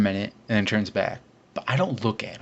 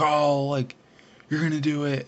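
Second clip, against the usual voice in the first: mock excited; 5 semitones higher than usual. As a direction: imitate friends egging you on. Friends hyping him up.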